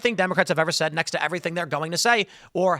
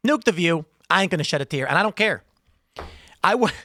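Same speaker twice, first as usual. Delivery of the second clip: ordinary talk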